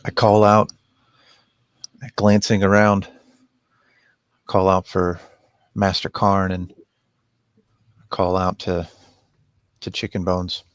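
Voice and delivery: calling out. Acted. Player calls out in scene.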